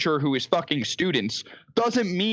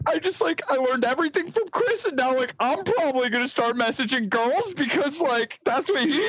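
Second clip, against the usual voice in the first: higher, whiny imitation of a man sobbing his eyes out; mock sobbing; 8 semitones higher than usual. Mocking the weeping guest to his podcast co-host, higher mock sobbing. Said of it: "he's sobbing his eyes out".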